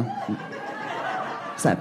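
ordinary talk, nothing marked

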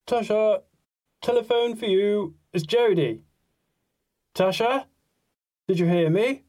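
high, loud call through the house. A narrator's reading. Father calls his daughter to the telephone, calling out. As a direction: holler for someone in another room.

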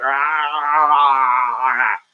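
strangled open-mouthed ah sounds, jaw locked open; broken-jaw groans. Retold or imitated. Bouncer imitates the injured guy's moans, jaw stuck open. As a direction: groan like you can't close your mouth. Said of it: "He went".

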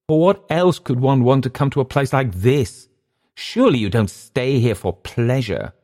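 high, astonished protest; incredulous Englishman. Acted. Englishman interrupting his host about money, high and incredulous. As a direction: exclaim in disbelief, pitched well up.

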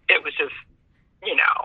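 ordinary talk, nothing marked

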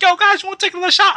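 high, quick voice of the guy offering shots; coworker's offer. Retold or imitated. Coworker offering another round, high and quick. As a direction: pitch it up and rattle it off fast. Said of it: "It was like".

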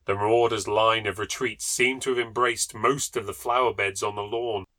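usual speaking voice

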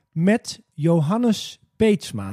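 drawn-out slow official phone greeting. Shown as a demonstration. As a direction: say it extremely slowly, officially. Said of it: "officieel moet je dus heel langzaam praten".

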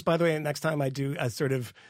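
ordinary talk

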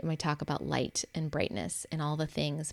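ordinary talk, nothing marked

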